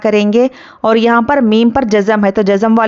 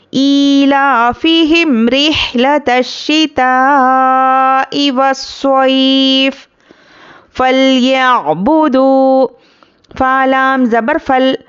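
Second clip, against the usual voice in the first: slow chanted tilawat; Quran recitation; 2 semitones higher, much slower than usual. Shown as a demonstration. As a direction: recite slowly with long vowels. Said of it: "teacher recites". (धीमी तिलावत)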